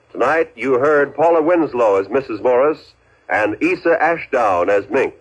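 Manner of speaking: ordinary talk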